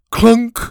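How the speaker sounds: loud, high sound-effect word; clunk noise. A narrator's reading. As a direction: punch out the clunk like the mechanism. Imitated mechanical noise in story.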